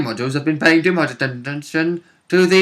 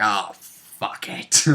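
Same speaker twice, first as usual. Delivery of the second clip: dismissive cat; 7 semitones lower, 7 dB quieter, much slower than usual. Retold or imitated. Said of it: "cat was like".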